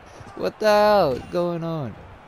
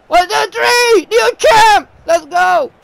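high loud victory shout; excited counting; 12 semitones higher, 6 dB louder than usual. Real, not acted. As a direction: celebrate the pin. Counting the pin, celebrating.

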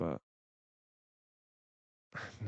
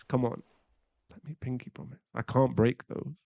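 normal talking voice

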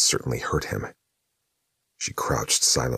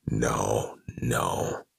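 interrupting demon; 3 semitones lower, 5 dB quieter than usual. A narrator's reading. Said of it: "the demon interrupted".

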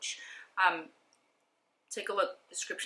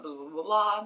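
ordinary talk, nothing marked

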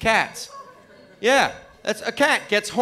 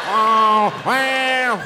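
cat wails climbing higher and louder; yowling cat building to shout; 5 semitones higher, 5 dB louder than usual. Retold or imitated. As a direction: wail like a possessed cat, rising.

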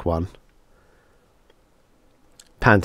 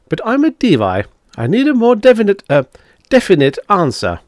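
lightly voiced Devi, higher and louder than narration; assured alien Devi; 13 semitones higher, 6 dB louder than usual. A narrator's reading. Devi demands a definite answer from a human, lightly assured.

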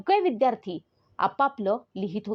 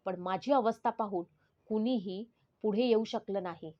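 usual speaking voice

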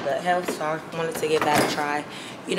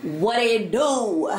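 drawn-out, higher goofy greeting; 4 semitones higher, much slower than usual. Real, not acted. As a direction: stretch it out in a silly put-on voice. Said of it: "I don't know why my voice is like this".

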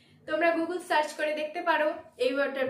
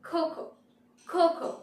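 "খো খো" with British aspirated k; British accent; 3 semitones higher, faster than usual. Shown as a demonstration. Teacher demonstrating the British accent, "খো খো" with puffed k. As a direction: say cocoa the British way, "খো খো". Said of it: "the British accent is".

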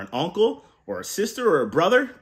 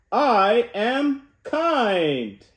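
rousing call to repeat; 6 semitones higher, much slower than usual. Shown as a demonstration. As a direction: stretch it big so kids join in. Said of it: "on the count of three".

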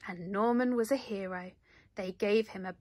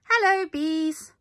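high, loud queenly greeting; 7 semitones higher, 10 dB louder than usual. A narrator's reading. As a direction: greet the class like a cheerful queen bee. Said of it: "said the Queen".

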